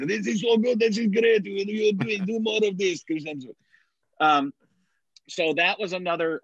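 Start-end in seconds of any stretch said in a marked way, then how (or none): 0.1-3.5 put-on Russian accent. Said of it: "I think I was in Russian accent".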